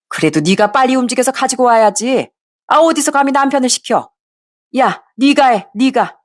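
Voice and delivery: a hint of a mother-in-law telling off her daughter-in-law; scolding mother-in-law. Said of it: "아주 큰 소리로 절 혼내키곤".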